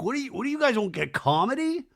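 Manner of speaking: high, loud defensive mimic; mock indignant bro; mocking Jesse Solomon. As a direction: whine it like a defensive guy. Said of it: "Jesse Solomon was like".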